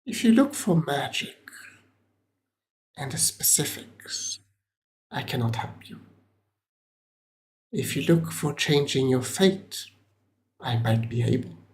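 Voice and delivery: lightly voiced cryptic seer, dropping away at phrase ends; hint of an enigmatic merchant, with weighty pauses; mysterious trader. Trader offering to change an adventurer's fate, lightly voiced mystic.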